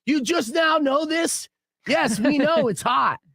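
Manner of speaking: high, drawn-out exasperated comeback; retelling a fed-up late-night retort; irritated retort. Retold or imitated. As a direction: act out being irritated, pitched high. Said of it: "I'm like, I'm irritated".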